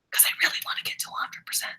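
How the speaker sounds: fast, breathy confiding whisper; whispered aside. Real, not acted. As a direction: rush it out under your breath like a secret. Teacher's quick aside to class.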